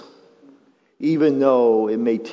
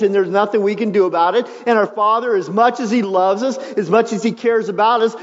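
louder, higher preaching that builds fast; full-force preaching; 9 semitones higher, 5 dB louder than usual. Acted. Pastor to congregation, on God not asking permission, preaching at full force.

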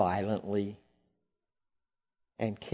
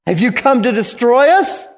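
high, loud frightened question; loud fearful demon; 11 semitones higher, 8 dB louder, faster than usual. Acted. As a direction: shout it as a panicked spirit.

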